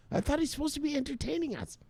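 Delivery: high, soft squeaky voice of a griping viewer; squeaky mock viewer. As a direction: whine it like a let-down fan. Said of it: "you're like".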